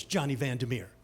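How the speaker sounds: low, soft, offhand trivia answer; cool quick answer. Retold or imitated. Trivia player on fire answering the machine, low and assured. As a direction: drop the name low and assured. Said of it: "I'm saying it like that".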